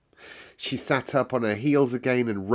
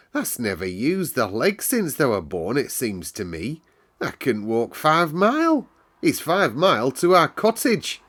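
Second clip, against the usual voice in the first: bright, higher Yorkshire lass, chatty and sure of herself; a cheerful Yorkshire servant girl telling the weather; Yorkshire maid Martha; 6 semitones higher, 4 dB louder than usual. Acted. Housemaid Martha explains the moor weather to Mary, lively Yorkshire lass.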